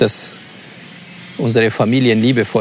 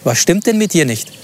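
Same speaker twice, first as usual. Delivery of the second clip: gloating and higher, rubbing it in; mocking boast; 6 semitones higher than usual. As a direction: taunt her with God's gift. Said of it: "und gesagt wird".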